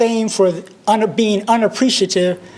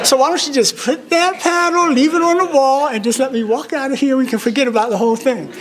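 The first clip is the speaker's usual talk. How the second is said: higher-pitched mock pleading; imitated youthful protest, pitched up; retold indignant protest; 7 semitones higher than usual. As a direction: act out a kid's silent protest to the headmaster. Acts out his inner teenage protest.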